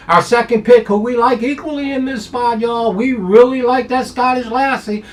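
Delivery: high, loud hyped-up call; excited hype. Real, not acted. Handicapper pumping up his top pick, loud and high. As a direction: shout out the top pick like a showman.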